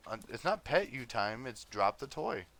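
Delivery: loud, slow scolding of the dog; firm scolding. Real, not acted.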